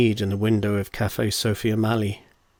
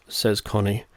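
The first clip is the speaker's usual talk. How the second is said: plain reading voice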